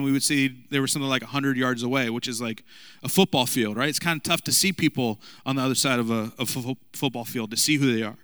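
ordinary talk, nothing marked

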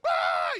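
a sudden low, very loud yell of fright; loud panicked scream. Retold or imitated. Teens running from exploding fireworks, loud scream.

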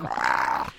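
low distorted growl, drawn out; mock gravelly roar. Podcaster mocking a singer's fake distortion, gravelly roar. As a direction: roar like an overdriven fuzz amp. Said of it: "You're making this gravelly sound".

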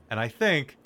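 ordinary delivery

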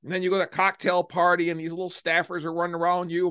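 ordinary talk

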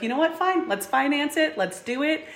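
high, resigned fine, let's do it; exasperated giving in. Retold or imitated. To her dad, a high exasperated surrender. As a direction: throw up your hands and give in.

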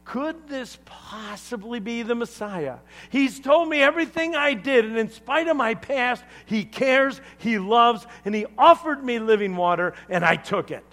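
high, loud, rushing excited witness; excited testimony. Acted. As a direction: pour out amazing news, high and loud. Voicing Sam's excited testimony to villagers.

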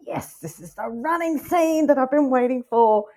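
high, loud, drawn-out triumphant cheer; slow, elated "at last" exclamation; retold excitement. Retells delight when the chase began.

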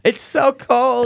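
mock whiny complaint. Mimicking shivering people in line.

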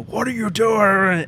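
high, loud and slow mock angry uncle; imitating castmate's big onstage yell; retold yell. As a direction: bark it like a furious stage uncle. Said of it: "he's yelling".

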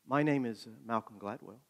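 soft, hesitant and mild; gentle. Retold or imitated. Famous writer shyly giving his name to a stranger, gently. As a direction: answer very gently, dropping almost to nothing. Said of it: "oh so gently he said".

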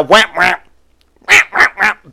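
high, short croaky yaps; strained cat squawk. Retold or imitated. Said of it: "just the sound it makes now".

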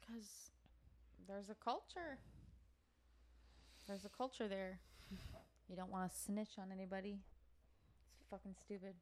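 quiet, almost whispered aside; hushed. Real, not acted. Hushed aside about snitching.

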